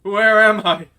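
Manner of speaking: loud, high cry of confusion; panicked. Acted. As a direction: burst out in a panic, voice jumping up. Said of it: "in a panic".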